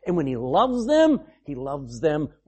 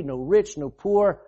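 ordinary talk, nothing marked

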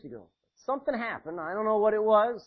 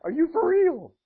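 startled Mary; 10 semitones higher, 6 dB louder than usual. Retold or imitated. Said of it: "she's like".

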